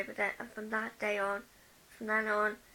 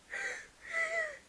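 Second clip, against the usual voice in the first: laugh. Real, not acted. Laugh after imitation.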